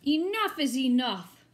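loud, fed-up mayor; mayor's outburst. Acted. The mayor huffing at the cranky crowd, loud and fed up. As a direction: bark it out like a cross mayor.

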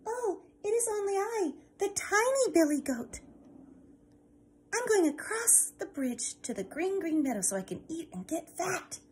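a tiny, timid goat voice; tiny goat answering the troll, pitched high. Acted.

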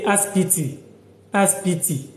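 "aspiti" said "as-pi-ti", no h, even syllables; Hausa "aspiti". Shown as a demonstration. Teacher showing local word for hospital, clear. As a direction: drop the h: "aspiti", three crisp syllables. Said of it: "we don't say the hospital, but we say aspiti".

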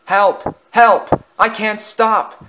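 high, loud cry for help; panicked cry. A narrator's reading. Runaway engine with nobody in his cab, panicked cry. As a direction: cry out as if careering out of control. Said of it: "he cried".

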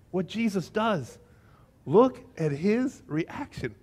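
normal talking voice

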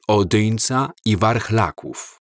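ordinary delivery

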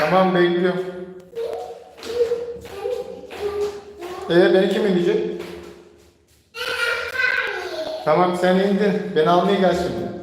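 high, sing-song play voice; playful high voice. Pretend. Father plays helpless with daughter.

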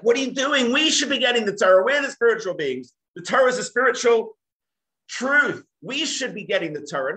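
high, loud indignant complaint; protesting angels. Acted. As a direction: demand the Torah as your right. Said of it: "the angels are like".

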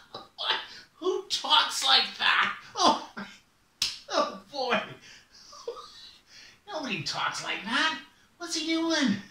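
laughing raven voice. Acted. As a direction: scoff at the silly bear from above. Raven mocking bear.

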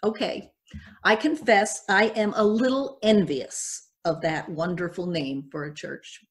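ordinary talk

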